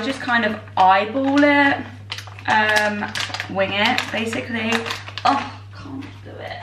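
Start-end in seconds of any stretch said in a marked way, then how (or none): none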